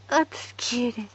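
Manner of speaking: ordinary delivery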